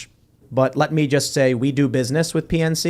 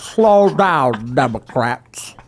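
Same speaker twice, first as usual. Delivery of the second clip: put-on very slow, dragged-out old politician caricature; insulting slow-motion senator impression; Mitch McConnell; much slower than usual. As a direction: drag every word out in mockery. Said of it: "And McConnell talks like this".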